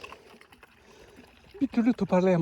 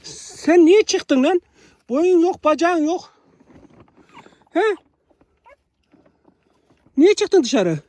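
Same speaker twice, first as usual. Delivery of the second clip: high-pitched, louder pet scolding; slow opening, high fond telling-off; scolding a puppy; 7 semitones higher, 3 dB louder, much slower than usual. Real, not acted. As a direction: tell off a tiny puppy, voice pitched up. Mock-scolds small puppy for wandering out.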